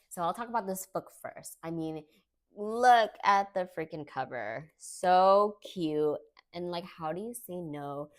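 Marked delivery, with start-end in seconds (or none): 2.6-6.2 louder, gushing over the cute cover; excited gushing. Real, not acted. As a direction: squeal it with delight at the cover.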